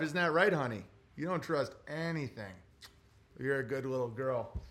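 soft talk to dog. Real, not acted. Streamer praising his skittish dog, soft and tender. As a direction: coo to a skittish pet.

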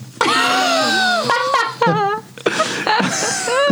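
very high squealing laugh; long, wailing burst of real laughter; helpless laughter. Real, not acted. Friend cracking up at the mother question, squealing laugh.

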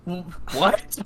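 halting, taken-aback what; baffled stammer. Retold or imitated. Delivery driver answering a rude customer, baffled stammer. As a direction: trip on the word in disbelief.

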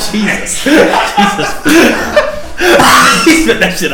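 high, loud laughing fit; cracking up, barely getting words out; laughing hard. Real, not acted. Friend spits out blue thing.

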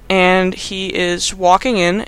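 ordinary talk, nothing marked

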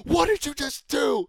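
high, loud, slow furious yell; retold scream. Counselor catching a kid mid-punch, screaming furiously. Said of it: "And he's screaming at the kid".

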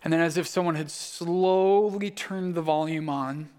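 normal talking voice

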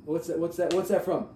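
ordinary talk, nothing marked

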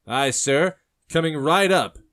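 high, loud, obliging barkeep call; eager barkeep. Acted. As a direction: sing it out eagerly to a customer. Eager barkeep takes the order.

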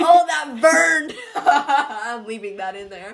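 high, loud, slow laughing. Real, not acted. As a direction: crack up while saying it. Keeps laughing after a sound.

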